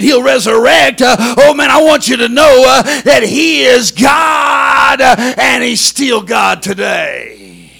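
loud, fiery, shouting sermon; full-force preaching. Acted. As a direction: preach it at the top of your lungs.

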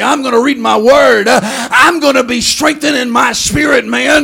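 loud, rising preacher's cadence; full-force preaching. Acted. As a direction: drive the faith home to the congregation.

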